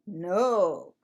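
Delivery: usual speaking voice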